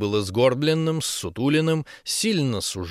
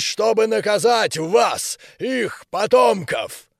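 loud, slow, higher commanding giant general; booming giant voice; 6 semitones higher, 3 dB louder than usual. A narrator's reading. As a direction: boom each phrase like a hammer on an anvil. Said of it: "звучало как стук молота по наковальне".

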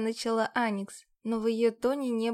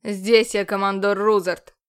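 louder, stern correction; 2 semitones lower, 8 dB louder than usual. A narrator's reading. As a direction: firmly put her in her place. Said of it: "строго напомнил он".

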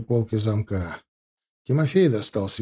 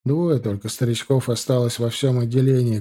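ordinary delivery

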